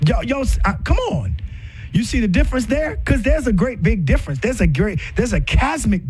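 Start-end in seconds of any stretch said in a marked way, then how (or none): none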